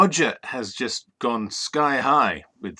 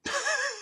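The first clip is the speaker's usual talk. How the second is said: high squeaky laugh; 8 dB quieter than usual. Real, not acted. Laughs at compliment.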